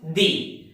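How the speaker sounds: "the" with an ee vowel, like "dee"; "the" as thee. Shown as a demonstration. Teacher explains from the transcription, "the" as thee. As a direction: say "the" to rhyme with see. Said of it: "so this word is pronounced".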